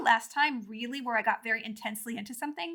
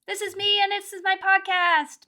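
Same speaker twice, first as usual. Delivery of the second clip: showy greeting; 8 semitones higher, 4 dB louder than usual. Acted. Podcast greeting in put-on voice.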